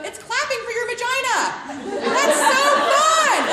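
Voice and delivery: high, loud laughing squeal; giggling delight. Real, not acted. Host laughing with guests as the toy vibrates. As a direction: laugh through the words, thrilled. Giddy over toy demo.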